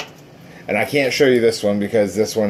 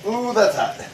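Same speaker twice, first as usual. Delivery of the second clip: startled yelp; 11 semitones higher than usual. Real, not acted. Steam from draining pasta.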